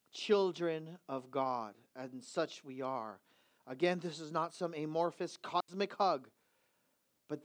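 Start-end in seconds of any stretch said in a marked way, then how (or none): none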